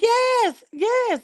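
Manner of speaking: high, loud yelling yes; hammed-up imitation of mom shouting yes; mom's joyful shout. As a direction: mimic mama cheering at the news. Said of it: "She was like".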